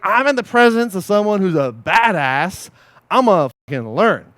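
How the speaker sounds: loud, slow, emphatic resolve; fired-up self-talk. Acted. Coach modelling the mindset beside someone skilled, fired up. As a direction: punch it out with determination.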